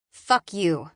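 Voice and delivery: understated angry retort; spat in anger. A narrator's reading. Scavenger storming away from the guard, mildly spat. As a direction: spit it at him, with restraint. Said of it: "I spit at him".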